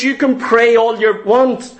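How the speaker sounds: ordinary talk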